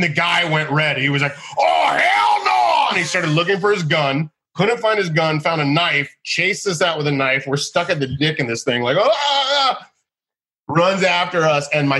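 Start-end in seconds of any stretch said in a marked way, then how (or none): 1.6-2.9 slow, very high outraged yell; furious shout. Retold or imitated. Barber's furious reaction, retold. 9.0-9.9 high, breathless yelps of fear; panicked cries. Retold or imitated. Being chased with a knife.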